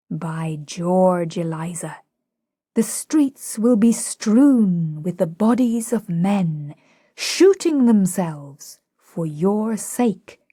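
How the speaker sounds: slow, grand elocution; thrilling elocution, slowed right down; elocutionary style. A narrator's reading. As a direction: orate slowly like a proud teacher of speech. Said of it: "most thrillingly beautiful low tones in his best elocutionary style".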